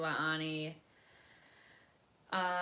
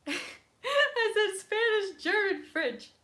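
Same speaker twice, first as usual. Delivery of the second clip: laughing, much higher and slowed; laughing at her own language mix-up; 8 dB louder, much slower than usual. Real, not acted. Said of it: "laughing at mixing languages".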